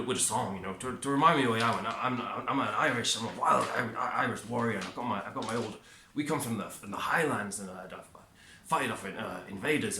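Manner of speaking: put-on lightly done working-class Irish accent; Conor McGregor Irish accent. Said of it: "I'll try and do his accent, his Irish accent".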